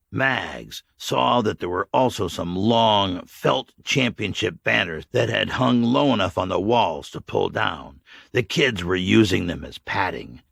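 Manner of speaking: ordinary delivery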